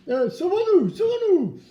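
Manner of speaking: high, loud hammed-up panic; mock fright. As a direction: pretend to run from the wolf. Pretends to flee the wolf.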